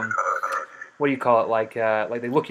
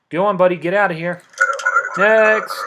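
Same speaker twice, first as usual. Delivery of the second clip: loud, barked send-off to the entrant; gruff dismissal; 5 semitones higher, 7 dB louder than usual. Acted. Border guard shouting the next traveller forward, loud. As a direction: shoo him off and call the next one loudly.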